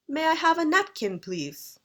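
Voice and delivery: British tune, high on have, falling on please; British intonation. Shown as a demonstration. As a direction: ask it the British way, stepping down at the end. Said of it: "If you're British, you might say".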